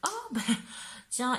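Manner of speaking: high, surprised oh, a sudden recognition; a playful gasp of recognizing someone; mock surprise. To the viewer, acting out running into her ex, mock surprise. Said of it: "Vous dites".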